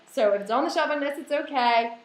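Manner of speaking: usual speaking voice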